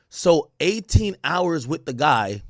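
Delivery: ordinary delivery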